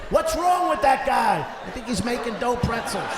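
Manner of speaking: loud high-pitched heckle from the street; mock passerby. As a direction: shout it like a gawking passerby. Passerby voiced mocking him.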